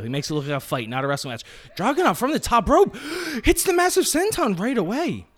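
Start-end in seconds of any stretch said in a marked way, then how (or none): 1.7-5.3 much higher, excited commentator call; excited play-by-play. Acted. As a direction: call the move like a ring announcer, pitched way up. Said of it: "doing play-by-play commentary".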